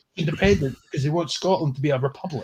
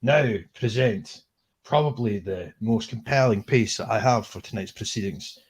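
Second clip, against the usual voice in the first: low, measured TV presenter delivery; 5 semitones lower than usual. Acted. Host introducing a guest on screen, low mock-formal presenter voice. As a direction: lower the voice and announce it like a host. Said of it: "It's my presenter voice".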